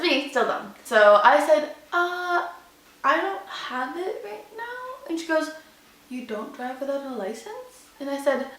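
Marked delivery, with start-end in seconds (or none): 1.9-5.1 high, soft, slow retold confession; small voice, drawing it out reluctantly; sheepish admission. Driver admits she has no license on her, sheepish. 6.1-7.9 much softer, retold incredulous question; hushed disbelief. Woman questions driver about missing license, hushed disbelief.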